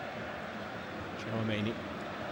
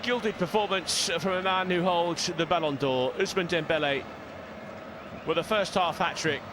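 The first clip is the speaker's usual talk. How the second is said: raised, higher-pitched match commentary; half-time commentary; 7 semitones higher than usual. Real, not acted. Commentator summing up a first-half hat-trick for viewers, raised commentary voice. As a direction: lift the voice to round off the half.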